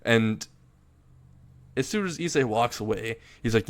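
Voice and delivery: plain reading voice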